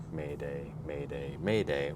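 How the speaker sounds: slow, separate Maydays with pauses; measured Mayday call. Retold or imitated. Echoing the co-host's careful reading of a distress call, measured. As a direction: space out each "Mayday" evenly and calmly. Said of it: "how you were saying the Mayday".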